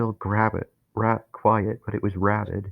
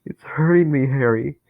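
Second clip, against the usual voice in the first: higher, louder, whimpering voice; whiny, small, afraid; 7 semitones higher, 6 dB louder than usual. Acted. Dennis crying to Harry in pain, whiny and afraid. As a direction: whine it like a hurt child. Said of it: "whiny and small and afraid".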